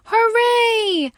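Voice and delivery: high, loud hooray; cheering shout. Acted. Neighbors cheering the rescued cat. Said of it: "Pig and neighbors shout".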